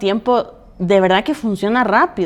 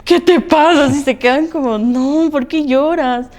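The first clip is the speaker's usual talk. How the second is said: put-on high, worried children's voices; imitating worried kids; 7 semitones higher than usual. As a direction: mimic the kids' alarm at mum crying. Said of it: "porque dicen". (hijas preguntando preocupadas, voz aguda)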